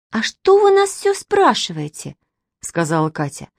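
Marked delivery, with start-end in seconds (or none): 0.1-2.2 put-on very high, girlish protest; high-pitched cheeky comeback; indignant retort. A narrator's reading. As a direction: throw the question back, affronted. Katya snaps back at the girl.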